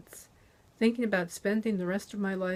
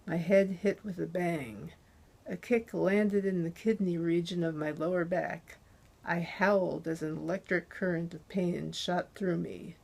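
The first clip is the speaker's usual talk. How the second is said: usual speaking voice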